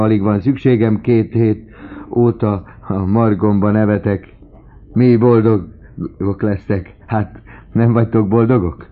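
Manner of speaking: loud, high-pitched exultant outpouring; overjoyed, voice lifted high and strong; elated father. A narrator's reading. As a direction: gush loudly with joy, chuckling partway through. Elated old father exults to daughters.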